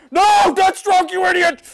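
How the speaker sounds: high, loud furious yell at a rival player; angry gamer yell. Real, not acted. Gamer yells at rival Deathstroke, furious shout. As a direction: scream it out in real frustration.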